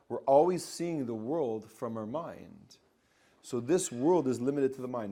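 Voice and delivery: usual speaking voice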